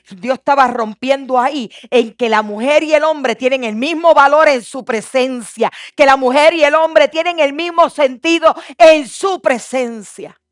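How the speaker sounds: loud, rising sermon build; fervent preaching. Acted. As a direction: preach it with full fire. Preaching at full force.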